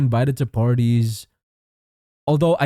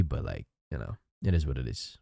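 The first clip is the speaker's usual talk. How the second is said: resigned quiet; 12 semitones lower, 11 dB quieter, faster than usual. Real, not acted. Resigned about covid.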